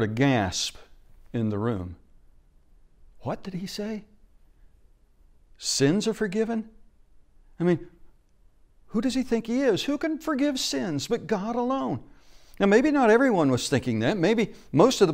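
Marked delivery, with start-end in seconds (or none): 3.2-12.0 a hint of scandalized onlookers, higher; shocked crowd. Preacher voicing the crowd's shocked muttering. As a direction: voice the crowd's outrage, pitched up.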